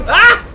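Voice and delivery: high, loud "Ah!"; short high-pitched yell; sudden yell. Real, not acted. Gamer reacting mid-fight, sudden high yell.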